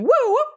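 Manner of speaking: very high whooping siren noise; police siren imitation. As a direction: wail like a cop siren pulling up.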